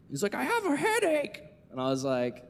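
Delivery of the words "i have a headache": high-pitched mock whine; imitation of a tearful child complaining; bawling kid. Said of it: "he literally starts bawling".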